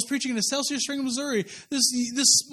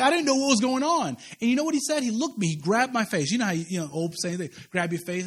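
ordinary talk, nothing marked

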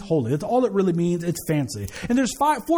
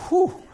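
laughing whew; 9 semitones higher than usual. Real, not acted. Relief after retold scolding.

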